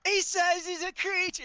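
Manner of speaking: high, loud gleeful jeer; mocking kid. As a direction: crow it to the whole gang. GM voices kid mocking Cadrix.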